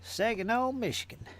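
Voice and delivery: put-on Southern twang, long last vowels; southern accent. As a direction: stretch the place names in a playful Southern accent. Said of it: "it's got a southern accent".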